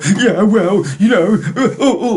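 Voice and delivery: laughing mock excuse-making; mock stammer. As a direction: splutter like a caught-out objector. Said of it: "And you're getting up".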